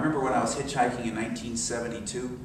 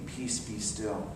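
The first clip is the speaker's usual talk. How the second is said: much softer, slow and low; hushed peace; 4 semitones lower, 10 dB quieter, much slower than usual. Acted. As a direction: lay the words down gently. Inner presence speaking peace.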